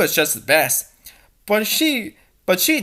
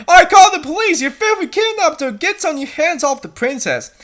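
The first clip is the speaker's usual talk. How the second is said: indignant threat; 9 semitones higher, 4 dB louder than usual. Acted. Character threatens the kidnappers.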